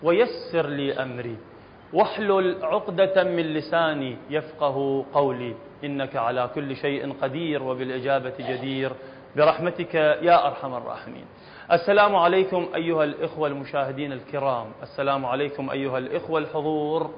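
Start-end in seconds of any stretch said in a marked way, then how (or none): 0.0-11.2 opening prayer. Acted. Teacher softly recites a prayer before class. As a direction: pray quietly before the lesson.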